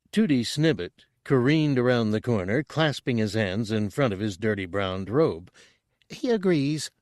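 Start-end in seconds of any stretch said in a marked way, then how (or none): 6.1-6.9 high, bubbly little alien voice; burbling Chadrafan. A narrator's reading. Chadrafan burbling to the hunters, high and eager. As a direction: burble it like an eager small creature. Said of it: "burbled the Chadrafan".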